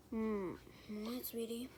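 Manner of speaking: soft whispered mom answer; sleepy whisper. Acted. Mother woken by her scared daughter, soft whisper. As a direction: answer half asleep, whispering.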